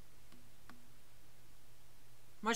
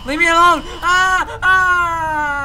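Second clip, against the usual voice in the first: high, loud shrieked refusals; loud panicked shrieks; terrified screaming; 11 semitones higher, 12 dB louder, slower than usual. Real, not acted. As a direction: scream for your life. Screams while chased.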